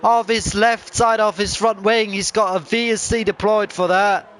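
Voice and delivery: high, loud, thrilled race call; excited motorsport commentator. Real, not acted. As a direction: call the crash as it happens. Crash call, motorsport commentator live.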